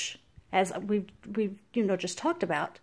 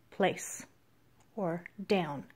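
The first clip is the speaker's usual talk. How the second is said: softer, calm low command; lowered voice; 3 semitones lower, 6 dB quieter, much slower than usual. Shown as a demonstration. Trainer calming a hyper dog, quiet and low. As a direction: settle the dog with a hushed word. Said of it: "bring your voice down".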